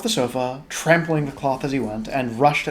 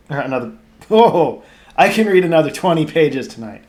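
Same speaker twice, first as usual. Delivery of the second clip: laughing; 3 semitones higher, 8 dB louder than usual. Real, not acted. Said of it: "Pesky decimal point".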